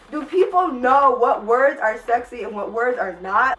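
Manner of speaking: loud, indignant question; exasperated rant. Real, not acted. Vlogger ranting at the book editors, loud and indignant. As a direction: demand it loudly, fed up. Ranting about unsexy words.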